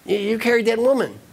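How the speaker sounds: high, sputtering accusation; acted outraged monk; angry monk. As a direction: stammer in mock indignation. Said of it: "his colleague is still angry".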